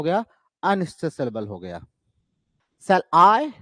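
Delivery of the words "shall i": light "Shall", then lift and punch "I"; stress on I. Shown as a demonstration. Teacher marking the stressed syllable in a sonnet line, stress on "I".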